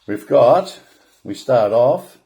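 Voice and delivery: ordinary delivery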